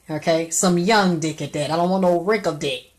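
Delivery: normal talking voice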